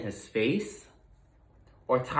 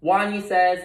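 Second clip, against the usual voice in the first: usual speaking voice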